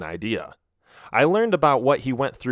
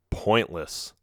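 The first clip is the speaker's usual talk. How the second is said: ordinary delivery, nothing marked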